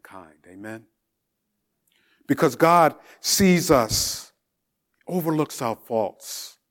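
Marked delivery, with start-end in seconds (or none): 2.3-6.6 preaching. Real, not acted. Preacher builds to full force.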